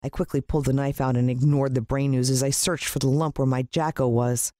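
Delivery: ordinary delivery, nothing marked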